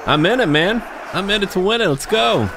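high, excited battle cry; pumped up. Real, not acted. Psyching himself up before the fight, high excited voice. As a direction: get hyped like you're charging in.

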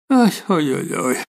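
sleepy moaning. A narrator's reading. Drowsy woman behind the curtain, sleepily moaning. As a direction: groan a little, as if just woken. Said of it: "si lamentò una voce insonnolita".

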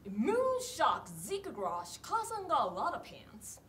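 Japanese sentence disguised as English, slow; slow fake English delivery; mock English. Shown as a demonstration. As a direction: stretch it out like English words. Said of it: "英語っぽく言いづらいセリフ".

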